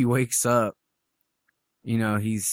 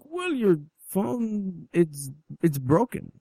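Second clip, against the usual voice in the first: lightly voiced captor, higher and hesitant; imitated soothing captor; 6 semitones higher, 3 dB quieter, slower than usual. Captor telling victim his phone is broken, mock soothing. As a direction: draw out the excuse, gently stalling.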